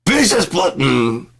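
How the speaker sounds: high, loud mock yell; outraged yell. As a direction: yell it at the clerk. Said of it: "I'm like".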